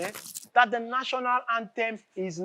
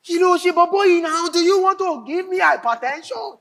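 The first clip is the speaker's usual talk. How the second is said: angry scolding; 6 semitones higher, 4 dB louder than usual. Acted. Boss scolding secretary.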